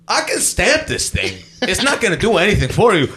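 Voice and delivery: high, emphatic official; imitated exasperated officer. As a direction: act out a guard explaining the obvious. Officer mockingly concedes the useless stamp.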